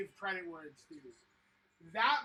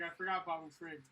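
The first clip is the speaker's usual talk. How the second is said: ordinary delivery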